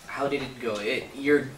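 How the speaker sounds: ordinary talk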